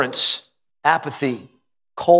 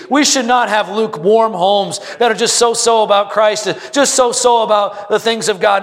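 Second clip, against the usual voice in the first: fired-up preaching; 7 semitones higher than usual. Acted. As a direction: drive it home to the congregation. Preaching at full force.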